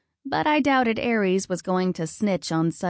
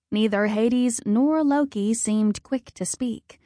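ordinary delivery

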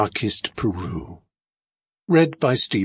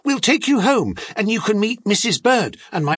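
put-on high-pitched girl, speeding up; eager young daughter; 11 semitones higher, faster than usual. A narrator's reading. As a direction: invite the bear home in a high voice. Girl inviting the bear home.

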